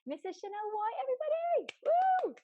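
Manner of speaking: shouting the guest's name with a high, loud cheer; excited welcome. Real, not acted. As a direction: hype up the guest like a show host.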